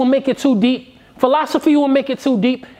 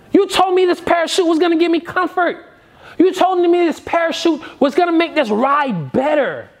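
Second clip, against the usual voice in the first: high-pitched indignant complaint; upset passenger; 6 semitones higher than usual. Acted. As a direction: protest like a let-down customer, pitched up. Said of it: "You're upset".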